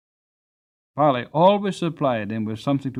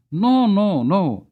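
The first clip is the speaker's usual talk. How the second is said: loud, high urgent protest; cried out; 8 semitones higher, 7 dB louder than usual. Acted. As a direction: call out to stop him in a rush. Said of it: "cried out".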